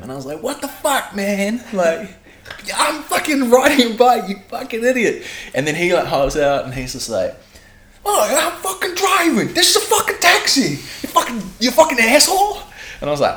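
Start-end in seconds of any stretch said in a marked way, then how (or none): 0.4-5.2 high, strained, indignant yell; full-on mock rant. Storyteller re-enacting going at taxi driver, high angry yell. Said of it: "just full on, like, went at him". 8.0-12.6 furious mock yell. Storyteller imitating taxi driver shouting at cyclist, high loud fury.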